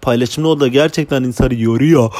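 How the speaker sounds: usual speaking voice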